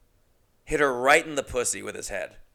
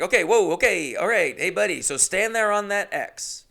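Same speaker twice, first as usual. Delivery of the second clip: flustered casting director; 8 semitones higher, 6 dB louder than usual. Retold or imitated. Casting guy wrangling the kid.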